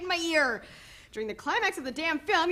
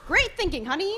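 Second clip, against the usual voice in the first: sarcastic; 5 semitones higher, 5 dB louder than usual. Acted. Sarcastic retort.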